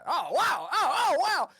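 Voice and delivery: high, loud swooping wows; amazed spectator cheers; awed crowd. Acted. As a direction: ooh and aah like an impressed audience. Crowd reacting to knife trick.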